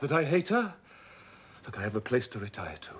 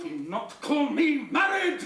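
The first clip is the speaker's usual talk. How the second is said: slow, loud, high shout; furious shout; 6 dB louder than usual. Acted. Rochester shouting that she must not call him married, shouting. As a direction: hurl it at her in anguish.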